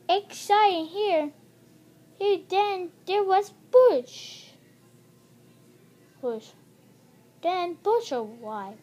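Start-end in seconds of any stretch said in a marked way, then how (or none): none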